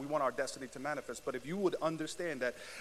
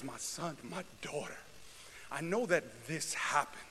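much softer, gentle fatherly reassurance; tender voice of God; 6 dB quieter than usual. Acted. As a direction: speak softly as a loving father.

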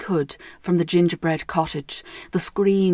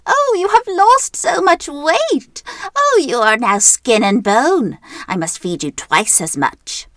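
high, loud cackling witch cry; 10 semitones higher, 6 dB louder, slower than usual. Acted. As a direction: wail it like a shocked old witch. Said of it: "cried out".

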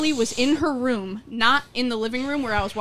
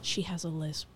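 imitated hushed disclosure, much softer and lower; confidential aside; 5 semitones lower, 14 dB quieter than usual. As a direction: quietly pass on a secret, as the sister did. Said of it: "pulled him aside and said".

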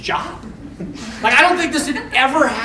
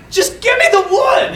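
exasperated demand; 10 semitones higher, 3 dB louder than usual. Retold or imitated. Demanding wood from the shopkeeper.

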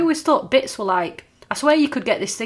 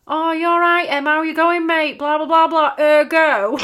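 lampoon a pretentious friend dropping ergo; mocking someone; 6 semitones higher, 4 dB louder than usual. Mimicking a certain person who always says ergo, hammed up and higher. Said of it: "a certain person who says it".

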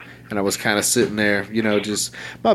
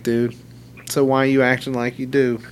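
usual speaking voice

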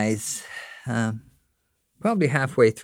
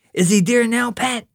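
put-on higher, eager small boy; little brother Gene; 12 semitones higher, 4 dB louder than usual. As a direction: pester your big brother, curious. Younger brother Gene asking.

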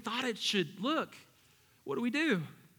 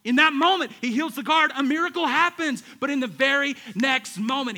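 preaching; 6 semitones higher, 9 dB louder than usual. Real, not acted. Preaching at full force.

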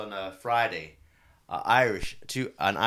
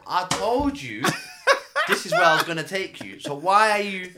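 raised voice; 8 semitones higher, 6 dB louder than usual. Retold or imitated. Driver retelling arguing with passengers.

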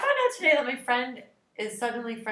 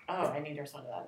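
whispered self-talk; 6 semitones lower, 9 dB quieter than usual. Retold or imitated. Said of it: "just being like".

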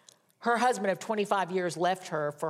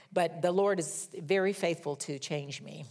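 ordinary talk, nothing marked

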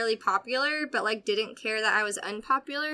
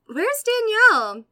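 higher, slow teacher question; imitating teacher; 10 semitones higher, 6 dB louder, slower than usual. Teacher asking the class about a missing student, higher and slow. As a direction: play the puzzled teacher. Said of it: "The teacher goes".

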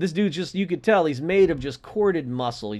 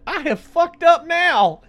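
dawning dread; 13 semitones higher, 7 dB louder, slower than usual. Retold or imitated. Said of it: "just his face went".

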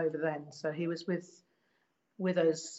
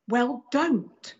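higher, louder mock-exasperated reply; retold brusque rebuff; 7 semitones higher, 8 dB louder than usual. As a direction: act out shutting down a flirt, with force.

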